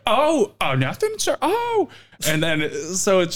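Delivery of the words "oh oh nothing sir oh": very high mock backpedalling; high-pitched imitated apologetic waiter; flustered server. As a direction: squeak out a servile retreat. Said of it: "you're just like".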